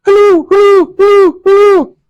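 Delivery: high, loud squawking hello; loud high seagull squawk; seagull call. Retold or imitated. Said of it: "they greet you with a".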